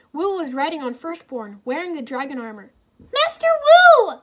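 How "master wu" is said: very high, loud joyful call; excited shout. A narrator's reading. Irene calls out to Master Wu on the dragon, high joyful shout. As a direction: call out to Wu in delight. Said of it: "Irene shouted, excitedly".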